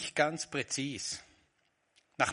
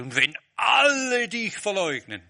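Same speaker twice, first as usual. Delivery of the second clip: 1 boastful vow; 7 semitones higher, 9 dB louder than usual. Acted.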